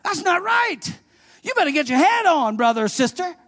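high, loud warning voice; a stern inner voice calling you back; voice of conviction. Retold or imitated. Holy Ghost correcting the believer, lightly voiced warning. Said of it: "he says to you".

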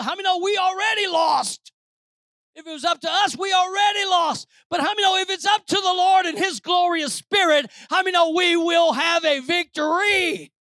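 high, shouted sermon build; rally the congregation with a loud, high cry; preaching at full force. Real, not acted. Preacher telling church the victory is the Lord's, full-force shout.

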